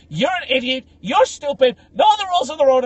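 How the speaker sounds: high, loud mock scolding; angry feminist bystander. Feminist berating the Russian driver, hammed-up anger. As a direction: imitate an outraged woman telling off a driver. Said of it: "this feminist came out".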